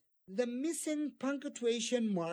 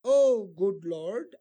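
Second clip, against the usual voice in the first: slow exclamation; 2 semitones lower, 9 dB louder, slower than usual. Shown as a demonstration. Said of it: "two exclamation marks here".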